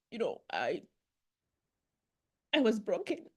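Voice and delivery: halting, choked-up recollection with long pauses; tearful. Real, not acted. Testifying about losing her mother, tearful. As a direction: fight back tears while recalling hurt. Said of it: "I'm so sorry for crying".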